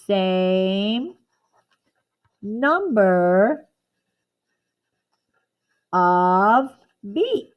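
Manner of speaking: very slow, one word at a time with long pauses; slow, drawn-out dictation of a definition. Shown as a demonstration. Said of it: "I found an example".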